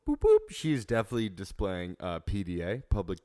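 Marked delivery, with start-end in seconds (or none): 0.1-0.4 1 radio beep imitation.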